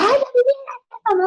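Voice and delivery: high-pitched mock yell at her son; imitated exasperated yell. Mother who used to end up yelling at Vladimir, high mock yell. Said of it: "antes terminaba gritando".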